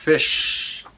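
Fish ending on a long, drawn-out sh; final SH sounded. Shown as a demonstration. Teacher shows learners S H like this, Fish with lingering sh. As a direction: hold the SH at the end of Fish. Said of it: "chữ S H like this".

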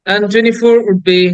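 ordinary delivery

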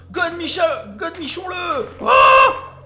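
loud, high outburst ending in a scream; frantic shouting. Real, not acted. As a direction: shout it wildly, building to a yell. (cris déchaînés)

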